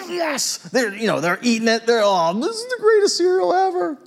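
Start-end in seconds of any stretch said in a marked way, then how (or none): 2.0-4.0 put-on much higher, thrilled little-kid voice; gushing kid voice. Kids at breakfast rave about the cereal, high and gushing. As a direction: squeal it like a kid tasting candy cereal. Kids delighted by Lucky Charms.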